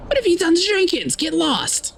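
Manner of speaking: shrill panic of a man who trusts no one; paranoid accuser. Acted. Paranoid man accusing through door.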